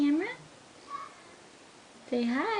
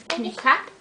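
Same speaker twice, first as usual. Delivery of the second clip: normal talking voice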